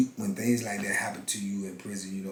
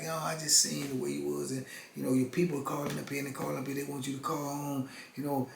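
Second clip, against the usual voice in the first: lightly voiced officer, higher and quick in the middle; a hint of the guard passing on the family's calls, higher; guard relaying message; 5 semitones higher, 5 dB quieter than usual. Guard tells him his people keep calling home, lightly imitated higher.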